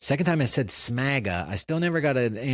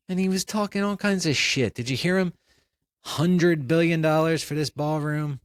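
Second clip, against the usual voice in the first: higher, exasperated ranting; incredulous rant; 8 semitones higher than usual. Real, not acted. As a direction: vent in disbelief. Host ranting after guest leaves.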